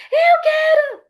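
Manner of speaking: grito agudo e alto de criança; criança gritando. Shown as a demonstration. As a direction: berre como criança birrenta. (criança em birra gritando com a mãe, aguda e alta)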